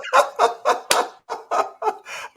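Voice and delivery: breathless high-pitched laugh bursting out; high unvoiced laugh. Real, not acted. Host bursting into laughter at guest's story. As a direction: laugh helplessly, high and airy.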